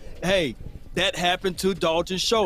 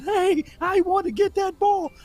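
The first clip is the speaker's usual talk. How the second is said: much higher mimicking voice; Playmaker high-pitched voice; 10 semitones higher than usual. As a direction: imitate a player piping up for the ball. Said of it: "he get that high-pitched voice".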